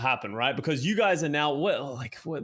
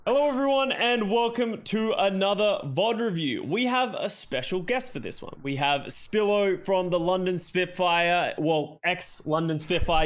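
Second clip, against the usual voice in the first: deep YouTube voice; 5 semitones higher, slower than usual. Acted. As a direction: open the video like a host. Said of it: "they always gotta drop it an octave".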